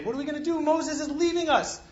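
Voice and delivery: anxious Israelites. Retold or imitated. Israelites fretting to God, high and worried. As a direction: sound lost as your leader leaves. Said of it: "and they say".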